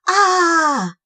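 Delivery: loud high battle cry; roar. Acted. Cobwobblies roaring at the snail. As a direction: roar as they charge.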